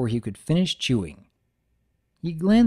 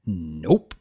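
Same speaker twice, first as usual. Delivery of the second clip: much lower, terse stallion; Big Mac nope; 7 semitones lower than usual. Acted. Big Mac refusing a cupcake, low and terse. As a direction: drop it deep and short.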